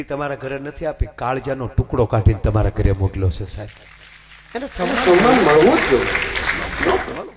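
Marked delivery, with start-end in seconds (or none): 4.5-7.4 much louder and very slow, rising on મળવું; emphatic proclamation. Real, not acted. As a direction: drive home મળવું જ જોઈએ with full force. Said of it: "સાત વાર કહું".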